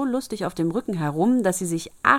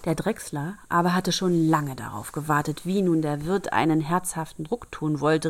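ordinary delivery

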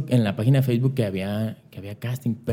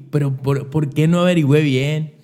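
raised, louder frustrated self-question; frustrated outburst; 5 semitones higher, 5 dB louder than usual. Retold or imitated. Kicking himself over the missed casting, frustrated and loud. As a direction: blurt out the worry that the chance is gone.

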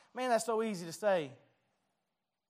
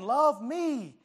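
louder, higher, pleading call to be loved; world calling out; 4 semitones higher, 4 dB louder than usual. Acted. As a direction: let the world shout its demand for love. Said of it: "This world is out here just saying".

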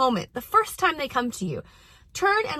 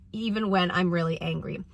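ordinary talk

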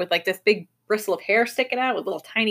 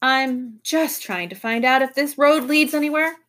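mildly exasperated, pitched up; weary exasperation; 5 semitones higher than usual. A narrator's reading. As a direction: explain with strained patience after a sigh. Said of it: "The professor sighed".

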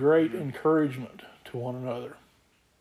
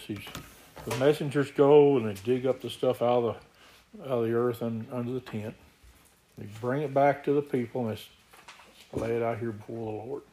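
ordinary delivery

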